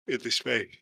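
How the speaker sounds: talking through a yawn; stretched, yawning words. Real, not acted. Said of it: "Excuse my yawn".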